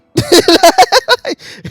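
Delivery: loud high-pitched laughter; real laughter, high and loud. Real, not acted. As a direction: crack up at the joke. Laughing at own joke.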